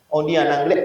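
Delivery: ordinary delivery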